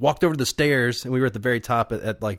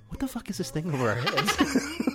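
confused actors; 8 semitones higher, 5 dB quieter than usual. Retold or imitated. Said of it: "waiting for the actors to go".

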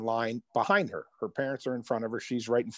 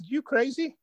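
much higher, shocked disbelief; incredulous. Retold or imitated. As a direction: squeak it out like that's unthinkable. Son refuses to leave game.

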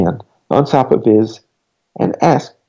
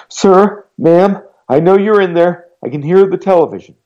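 put-on higher, louder girl calling through the door; frightened girl pressing her case; pleading girl; 8 semitones higher, 5 dB louder than usual. A narrator's reading. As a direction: insist she knows he's home.

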